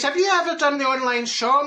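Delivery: mock calling-up, not a full shout. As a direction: shout up to him, lightly acted. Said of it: "I'm shouting up to him".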